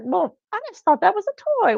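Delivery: very high-pitched mock disbelief; amazed stranger. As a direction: imitate someone squeaking in astonishment. Imitates the surprised stranger again.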